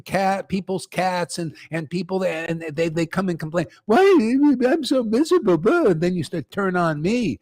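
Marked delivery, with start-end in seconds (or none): 3.9-5.9 a higher, mock miserable whine; whiny mock complaint. Teacher mocking visitors who complain about misery, higher whine. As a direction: lightly imitate a self-pitying complainer.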